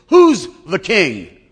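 a lordly lion demanding praise; slow booming challenge to the grizzly. Acted. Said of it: "he says".

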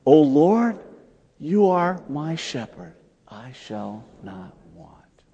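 slow prayer fading to a whispered end. Shown as a demonstration. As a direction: let the last line sink to a whisper. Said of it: "we could say that one again".

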